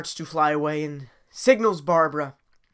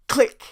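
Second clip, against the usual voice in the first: clicking sound; 6 semitones higher, 5 dB louder than usual. Acted. Sound effect of key turning.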